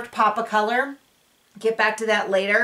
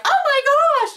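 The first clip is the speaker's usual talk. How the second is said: much higher, louder squeal of "Oh my gosh!"; imitating a shocked gasp; 5 dB louder, slower than usual. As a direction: shriek it up high like a mock-shocked housewife. Ramona's reaction at the dinner.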